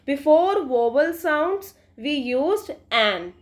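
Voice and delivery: very slow, word by word; slow dictation. Shown as a demonstration. Teacher dictating the grammar rule, very slow. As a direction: dictate the rule so they can write it. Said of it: "rule dictated to class".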